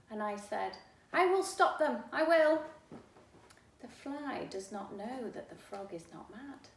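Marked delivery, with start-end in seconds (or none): 1.1-2.6 loud, high resolve; determined shout. Acted. Boy vows to stop the chase, loud and determined. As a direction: declare it boldly.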